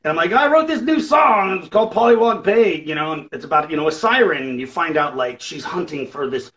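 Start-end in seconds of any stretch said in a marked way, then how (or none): 0.3-2.8 high, loud mock-proud announcing; hammed-up eager reveal; excited announcement. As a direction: imitate yourself crowing over a new song. Retold morning announcement of new song.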